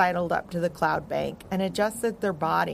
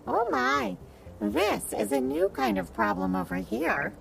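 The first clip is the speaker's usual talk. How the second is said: a tiny dragon, puzzled; little dragon voice; 3 semitones higher than usual. Acted. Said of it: "and said".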